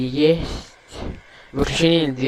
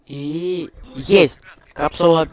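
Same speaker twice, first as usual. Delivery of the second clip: excited; 4 semitones higher, 10 dB louder, slower than usual. Real, not acted. Capsule crosses the Karman line.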